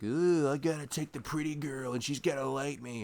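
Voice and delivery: high, put-on dumb jock voice; mock jock voice. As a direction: sneer it like a shallow high school jock. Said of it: "they're just like".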